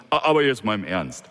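usual speaking voice

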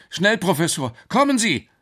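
high, loud plea to hurry; frantic boy calling out; urgent shout. Acted. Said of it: "rief Alex".

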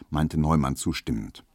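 ordinary delivery, nothing marked

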